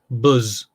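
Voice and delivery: drawn-out Brummie "buzz" for "bus"; Brummie pronunciation. Shown as a demonstration. Repeating the wife's local word, "buzz" in Brummie pronunciation. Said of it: "they say th-, weird things like".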